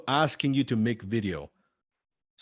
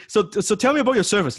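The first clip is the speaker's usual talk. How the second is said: much higher and louder, quick eager question; quick, pitched-up inquiry; prospect's question; 11 semitones higher, 6 dB louder, faster than usual. Retold or imitated. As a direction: voice an eager caller asking about the service. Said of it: "when they're on the phone talking to a prospect".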